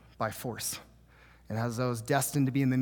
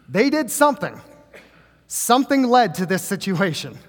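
higher and much louder, driving the point home; preaching at full force; 6 semitones higher, 7 dB louder than usual. Acted. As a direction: preach it hard to the congregation.

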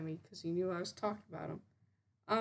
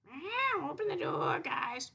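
higher pleading cat voice; a hint of a silly cat voice; 7 semitones higher, slower than usual. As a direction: beg at the garage door like a stoned cat. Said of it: "He's like".

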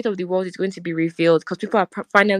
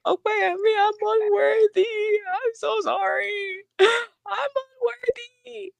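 very high, drawn-out tearful wail; wailing unworthiness; slower than usual. Retold or imitated. Retelling her cry to God at home, wailing. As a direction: cry it out slowly, gasping between phrases. Wails retold prayer on arriving home.

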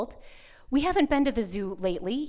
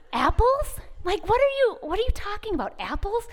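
high-pitched incredulous questioning; puzzled, higher-pitched mock disbelief; baffled mother; 8 semitones higher than usual. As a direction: re-enact a mom utterly thrown by the answer. Mother baffled by toddler's answer.

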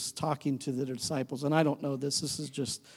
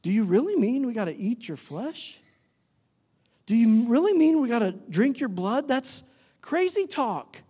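high, disbelieving questions; incredulous; 5 semitones higher than usual. Acted. Disciples balking at Jesus's hard teaching, incredulous. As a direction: question it in mock shock, stretching the verdict.

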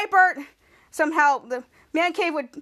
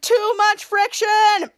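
slow, raised, loud yell; screamed complaint; 5 semitones higher, 5 dB louder, much slower than usual. Retold or imitated. Charlotte screaming down the shaft, slow loud yell. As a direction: yell it drawn out, imitating a sliding character. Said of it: "they were both screaming".

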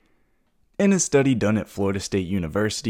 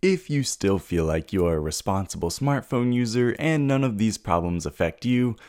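usual speaking voice

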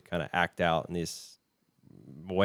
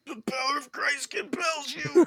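squeaky high mock exorcist. Exorcist quote.

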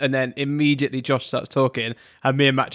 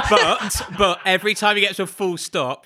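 plain reading voice

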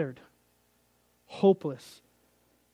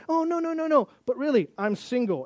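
much higher, breezy denial; mock girl's voice; 8 semitones higher than usual. Girl on TV insists she is single, mock high voice. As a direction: brush him off in a high chirp.

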